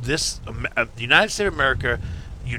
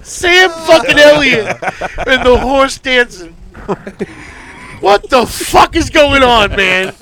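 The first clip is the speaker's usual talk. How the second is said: high, loud, slow exasperated laughing; cracking up in disbelief; laughing exasperation; 9 semitones higher, 7 dB louder than usual. Real, not acted. Host laughing at the Ted Danson mixup, loud and slow.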